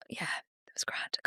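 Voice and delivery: hushed fast whisper. Real, not acted. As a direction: whisper it like a secret. Secretive aside about festival.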